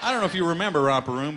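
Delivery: ordinary talk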